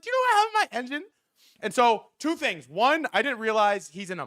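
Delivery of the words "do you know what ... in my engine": cocky boast. Retold or imitated. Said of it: "I'm like".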